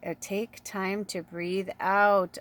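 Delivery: slow, drawn-out calming voice; slow breath-out guidance. Acted. Host guiding viewers to exhale, slow and calm. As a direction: ease them into breathing out slowly.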